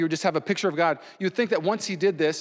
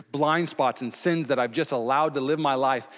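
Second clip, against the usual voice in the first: ordinary delivery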